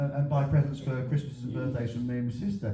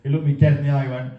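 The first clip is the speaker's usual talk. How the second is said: normal talking voice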